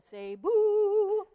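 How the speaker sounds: loud, high, drawn-out boo; Veggie Tales impression. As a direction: let the boo soar up high and long. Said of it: "brings me back to the Veggie Tale".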